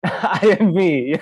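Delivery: slow, drawn-out laughing delivery. Real, not acted. Presenter reading an audience answer from the chat, laughing.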